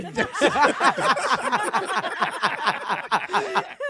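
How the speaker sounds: big burst of high laughter; loud laughter. Real, not acted. Story teller bursts out laughing.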